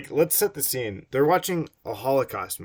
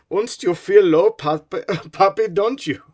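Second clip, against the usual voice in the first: high, loud mock German with laughs; a giggling comic German son; hammed-up German accent, laughing; 9 semitones higher, 12 dB louder than usual. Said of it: "How's a German talk?".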